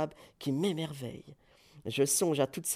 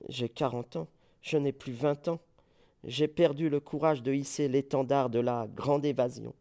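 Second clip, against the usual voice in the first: ordinary talk, nothing marked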